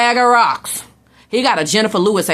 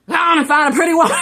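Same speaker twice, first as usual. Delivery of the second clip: laughing shout; 8 semitones higher, much faster than usual. Acted. Mother shooing son out laughing.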